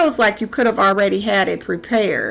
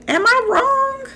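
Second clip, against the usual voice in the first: high incredulous question; 13 semitones higher than usual. Real, not acted.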